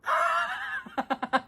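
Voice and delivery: a high, soft giggle; quiet high-pitched giggle. Acted. After gatekeeper line.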